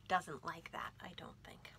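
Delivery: playful aside whispered. Real, not acted. Host whispers an aside to the children, unvoiced. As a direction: confide it in a hushed whisper.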